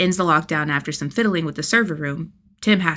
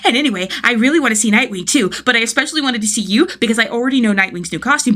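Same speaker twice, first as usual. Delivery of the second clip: put-on fast, high and loud kid chatter; words spilling out in a rush; talking too fast; 5 semitones higher, 6 dB louder, faster than usual. A narrator's reading. Tim rushing to tell Jason, breathless excitement. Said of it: "Tim is talking too quickly to follow".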